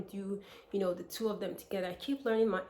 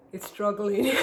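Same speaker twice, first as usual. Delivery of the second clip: louder, breaking into a real laugh; 5 semitones higher, 11 dB louder than usual. Real, not acted. Vlogger admitting her British accent is failing, laughing. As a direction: laugh at yourself as you say it.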